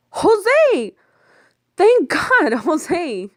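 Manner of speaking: very high, loud joyful cry with a laugh; calling to a rescuer, overjoyed; relieved shout. Acted. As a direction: call out in relief. Relieved cry at arriving truck.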